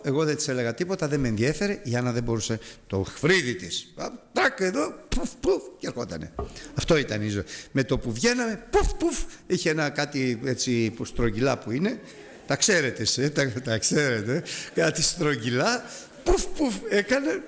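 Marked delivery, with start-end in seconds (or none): 4.0-5.6 high, bright πουφ πουφ puff sounds; mimicked makeup puffs. Preacher miming his wife powdering her face, high puffs. 8.7-9.2 high, bright πουφ πουφ puff sounds; mimicked makeup puffs. Preacher miming his wife powdering her face, high puffs. 16.2-17.4 high, bright πουφ πουφ puff sounds; mimicked makeup puffs. Preacher miming his wife powdering her face, high puffs.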